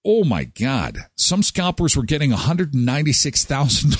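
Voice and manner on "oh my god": usual speaking voice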